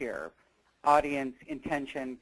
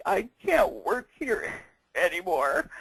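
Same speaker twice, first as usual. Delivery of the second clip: higher, tearful quote of her younger self; retold crying; 5 semitones higher, 3 dB louder than usual. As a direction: replay the breakdown with a catch of tears. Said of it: "And I just started crying".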